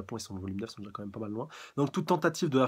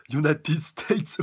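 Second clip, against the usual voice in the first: drawn-out, high, loud attack call; attack-name shout; 5 semitones higher, 9 dB louder, much slower than usual. Acted. Manga reader calling out All Might's finishing move, drawn-out shout. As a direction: stretch it out big and loud.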